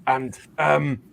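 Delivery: football manager with a bad throat; Sean Dyche. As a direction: sound like a manager nursing a cold. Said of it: "You're supposed to sound like Sean Dyche".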